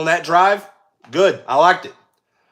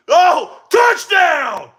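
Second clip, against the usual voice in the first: loud, high burst of joy; touchdown call; 13 semitones higher, 6 dB louder, much slower than usual. Real, not acted. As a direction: shout the touchdown like the crowd's with you. Calling a touchdown live.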